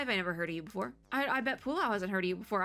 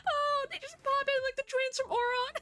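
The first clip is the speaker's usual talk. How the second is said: very high, giddy squeal; giddy amusement. Real, not acted. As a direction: squeal it in tickled delight. Cracking up at characters popping in.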